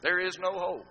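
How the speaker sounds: loud, high preaching with the words driven hard; fervent sermon cadence; fiery preaching. Acted. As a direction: preach it out to the whole church. Preaching at full force.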